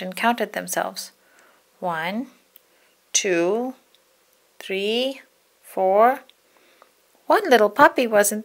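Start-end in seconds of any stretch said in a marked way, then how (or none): none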